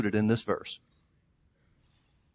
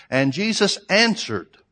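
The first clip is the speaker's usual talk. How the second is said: slow, higher, weight on "answered"; emphatic scripture quote; 6 semitones higher, slower than usual. Shown as a demonstration. Preacher making the congregation notice the verse, slow emphasis. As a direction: savor each word, pressing "answered". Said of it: "Please notice how the scripture reads here".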